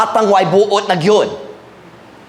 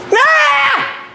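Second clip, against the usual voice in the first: put-on much higher wailing "Ah!" of a toddler; child's yelp. Preacher imitating son Joey's high protest squeal. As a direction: squeal like a small boy protesting.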